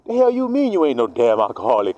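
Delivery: usual speaking voice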